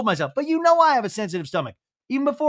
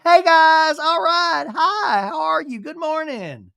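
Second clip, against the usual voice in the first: bright, much higher, louder greeting; cheery; 9 semitones higher, 4 dB louder, slower than usual. Retold or imitated. Brittany greeting friends in the morning, hammed-up cheery and higher. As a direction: sing out hello to the group. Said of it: "already cheery".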